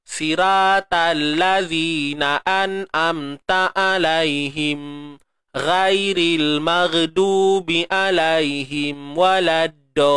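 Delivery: slow sung recitation with every letter thin; ignoring letter traits. Shown as a demonstration. Teacher showing a hardcore wrong recitation. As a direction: chant slowly, ignoring all thick letters. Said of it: "mengabaikan sifat seratus peratus".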